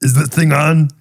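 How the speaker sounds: put-on Schwarzenegger accent. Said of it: "Or how about this?".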